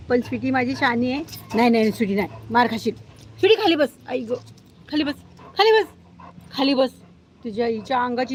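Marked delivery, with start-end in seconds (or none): 1.5-6.9 sharp command. Real, not acted. Owner orders the dog to sit down, sharp and raised.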